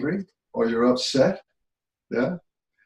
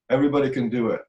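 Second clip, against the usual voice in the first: ordinary talk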